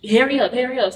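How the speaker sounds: pressing and impatient; retold impatient order. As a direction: imitate someone rushing you out. Retold order from angry partner.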